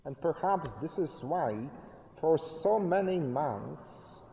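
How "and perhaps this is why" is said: ordinary talk, nothing marked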